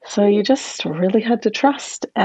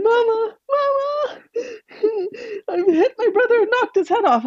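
high, sobbing wail; Anne-Marie crying to her mother in horror; crying child; 14 semitones higher than usual. Acted. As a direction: wail for Mama in tears. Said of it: "began to cry and scream".

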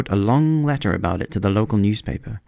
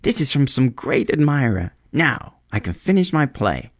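excited; 6 semitones higher than usual. Acted. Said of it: "he cried".

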